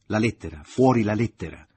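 loud, rising furious demand; angry shout. A narrator's reading. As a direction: shout it, losing patience. Man demands letter furiously. (grido furioso)

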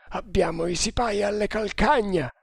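high-pitched urgent warning; crying out. A narrator's reading. Nagor warns that pursuers are close behind, crying out. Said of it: "gridò Nagor".

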